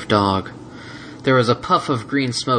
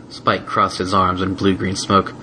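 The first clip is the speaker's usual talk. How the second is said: ordinary delivery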